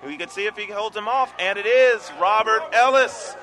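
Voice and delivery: rising, loud live race call; race caller. Real, not acted. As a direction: call the finish with building excitement.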